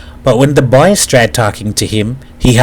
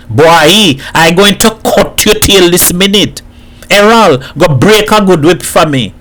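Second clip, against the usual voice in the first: raised, higher-pitched scolding; angry threat; 7 semitones higher, 3 dB louder than usual. A narrator's reading. Hat scolds Boy for his cheek, angry threat. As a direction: threaten him with a whipping.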